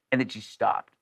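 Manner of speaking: ordinary delivery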